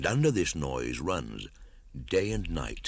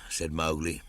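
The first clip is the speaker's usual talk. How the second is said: plain reading voice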